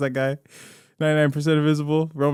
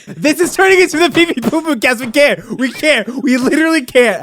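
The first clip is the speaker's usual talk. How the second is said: high, raised voice, ranting; exasperated outburst; 9 semitones higher, 4 dB louder than usual. Real, not acted. Podcast host fed up with the derailed show, exasperated. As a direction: throw up your hands in pitched-up exasperation.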